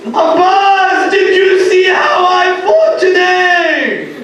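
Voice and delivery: high, loud, drawn-out call; wailing cry. Acted. Hussein calling to fallen Abbas in a wail. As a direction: cry the name out in grief.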